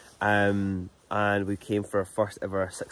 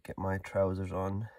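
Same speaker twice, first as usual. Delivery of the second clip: ordinary delivery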